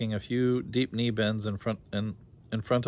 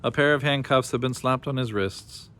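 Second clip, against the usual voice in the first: plain reading voice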